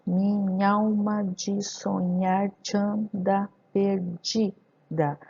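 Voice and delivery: "minha alma" and "te anda" merged, each syllable slow; poetic syllable count. Shown as a demonstration. Teacher counting poetic syllables, slow, separated, with elisions. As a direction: count it out as mi-nhal-ma, "te anda" as tchan-da. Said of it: "Fazendo essa contagem".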